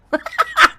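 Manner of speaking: sudden squealing laugh; high burst of laughter. Real, not acted. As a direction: cackle out loud. Other streamer laughs at rant.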